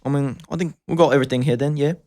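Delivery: hammed-up British chap; casual mock-British chat; British accent. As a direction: put on a cringy British accent. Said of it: "Can you do a British accent?".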